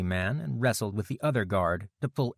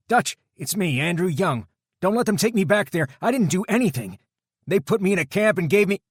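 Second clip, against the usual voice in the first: high, loud, rushed begging; desperate plea; 10 semitones higher, 7 dB louder than usual. A narrator's reading. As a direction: beg for your life. Terrified prisoner begging.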